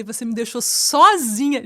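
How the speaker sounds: loud mock reproach, stretching "sozinha"; cobrança encenada. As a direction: playfully accuse him, slow and loud. Acted reproach at being abandoned. (cobrança exagerada, alongando "sozinha")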